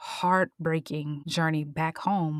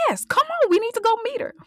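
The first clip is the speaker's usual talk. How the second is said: much higher, louder, urgent; eager princess; 7 dB louder, faster than usual. Retold or imitated. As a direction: hurry them along. Princess, quoted by girl.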